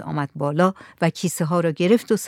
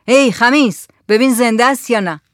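raised, higher-pitched calling voice; loud call; 4 semitones higher, 6 dB louder than usual. A narrator's reading. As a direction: call out loudly to someone across the room. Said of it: "با صدای بلند گفت". (با صدای بلند صدا زدن)

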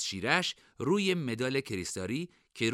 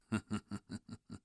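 soft, low-key taunting chuckle; sarcastic chuckle; 3 semitones lower, 9 dB quieter than usual. Acted. Rival taunts Lilo's team, quiet sarcastic chuckle.

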